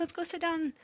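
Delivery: soothing mom voice. Retold or imitated. Mom comforting scared daughter.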